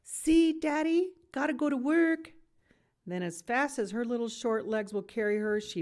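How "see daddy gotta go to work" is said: put-on high, loud smirking child; cheeky toddler. A narrator's reading. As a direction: tease like a mischievous little girl. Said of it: "smirks and says".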